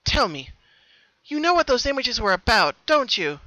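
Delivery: trying hard to sound serious; earnest demand; serious Pinkie. A narrator's reading. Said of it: "as seriously as she could".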